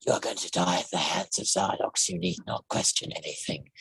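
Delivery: low, soft, menacing alien; menacing monster voice. Shown as a demonstration. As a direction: purr the threat like a villain with personality. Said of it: "that in the other voice is".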